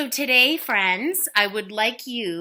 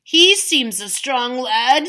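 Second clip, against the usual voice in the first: worried; 4 semitones higher, 8 dB louder, slower than usual. Acted. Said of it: "getting a little worried".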